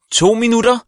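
put-on teacher shouting the time left to the boys. A narrator's reading. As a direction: call the countdown from behind. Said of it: "råbte Ditte K bag dem".

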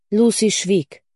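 ordinary delivery

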